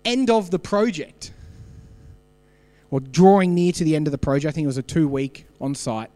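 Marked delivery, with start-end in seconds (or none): none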